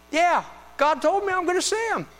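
high, loud eager voice; excited Simeon. Retold or imitated. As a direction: bubble over like an old man about to see a promise. Preacher voicing Simeon's excitement.

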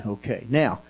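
ordinary delivery, nothing marked

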